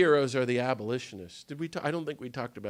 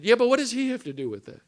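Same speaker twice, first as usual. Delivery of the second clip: high, loud and fast scoffing question; mock skeptical parishioner; 10 semitones higher, 6 dB louder, faster than usual. Parishioner brushing off Jesus, mock scoffing. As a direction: needle him like a dismissive churchgoer.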